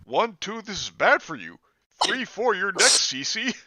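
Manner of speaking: high, loud demon creature voice; Chris Boers. Acted. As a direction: taunt her as the shadow creature. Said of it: "Chris Boers".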